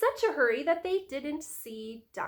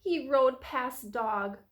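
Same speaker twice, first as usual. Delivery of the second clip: plain reading voice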